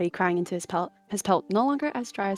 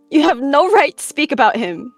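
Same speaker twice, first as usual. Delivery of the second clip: loud, raised-pitch outburst; furious snarl; 7 semitones higher, 11 dB louder than usual. A narrator's reading. She-cat rebuking the one who mocked the dead, furious. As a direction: snarl it out, outraged on his behalf. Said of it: "hackles rose with a snarl".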